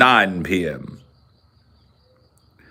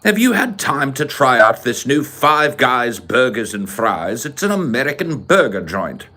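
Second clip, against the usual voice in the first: put-on British accent; 4 semitones higher than usual. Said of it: "it's like".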